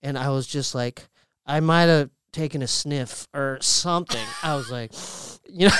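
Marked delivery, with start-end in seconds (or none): none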